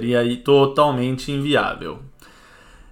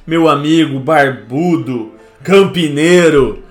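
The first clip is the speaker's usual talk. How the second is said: boxing announcer; 3 semitones higher, 8 dB louder than usual. Pretend. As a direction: boom it out like a fight intro. Introducing friend like boxing announcer.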